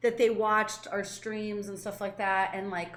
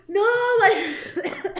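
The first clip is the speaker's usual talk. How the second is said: flustered laugh; 10 semitones higher, 5 dB louder than usual. Retold or imitated. Flustered reaction to fans, laughing.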